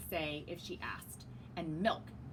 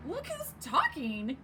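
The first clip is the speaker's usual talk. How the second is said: snippy retort; 6 semitones higher, 4 dB louder, slower than usual. Acted. Egg retorts.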